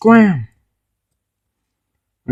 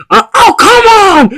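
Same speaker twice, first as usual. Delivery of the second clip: high, loud cry of frustration; frustrated shout; 13 semitones higher, 6 dB louder than usual. Pretend. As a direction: yell it as the plan falls apart. Toy play, plan fails.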